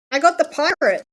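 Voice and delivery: delighted it's the one I hoped for; excited gasp of a win; excited reveal. Real, not acted. Unboxing reveals the pirate figure.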